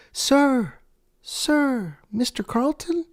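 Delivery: high-pitched receptionist calling after him. Retold or imitated. Receptionist calling after him in a high voice. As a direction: call out high and light, like a receptionist. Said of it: "I hear".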